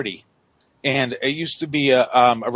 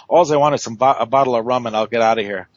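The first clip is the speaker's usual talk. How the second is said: usual speaking voice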